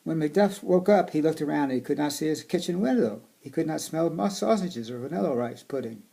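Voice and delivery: storybook reading voice; reading to children. Acted. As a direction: read it aloud to kids. Reading picture book aloud.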